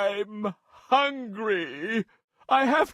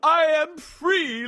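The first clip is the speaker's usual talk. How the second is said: much higher, happy and eager; relieved joy; 6 semitones higher than usual. A narrator's reading. Freed lion thanks the mouse, higher joyful voice. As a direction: burst out with relief at being free.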